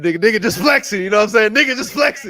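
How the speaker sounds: loud, high hype aside; hyped bragging. Real, not acted. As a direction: gloat about the flex. Aside bragging after hanging up.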